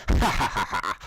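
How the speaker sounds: villain's mad cackle; maniacal laugh. Acted. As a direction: cackle madly, high and wild. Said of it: "laughed maniacally".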